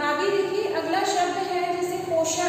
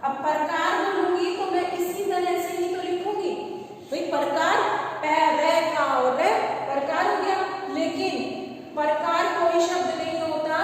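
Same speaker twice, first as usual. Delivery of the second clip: normal talking voice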